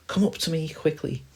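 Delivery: high squirrel voice calling down. Acted. Squirrel urging friends up the tree, calling high. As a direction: call them up urgently, pitched high. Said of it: "the squirrel calls".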